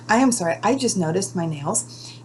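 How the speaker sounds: ordinary delivery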